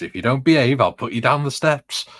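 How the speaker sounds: put-on a grandmother scolding naughty kids; nan's threat. Nan warning the grandkids, mock stern. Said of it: "my nan used to say to us".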